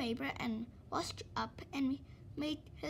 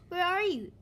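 higher, louder call; 5 semitones higher, 6 dB louder than usual. Acted. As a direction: call out through the house. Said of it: "he called".

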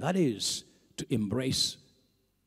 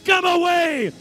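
loud, higher, driving preaching over music; preaching at full force; 14 semitones higher, 9 dB louder than usual. Real, not acted. Preacher calling the saints to come away, full force. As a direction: preach it home with fire.

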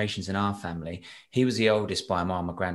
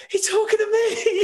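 excited; 5 dB louder than usual. Retold or imitated. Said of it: "and I was like".

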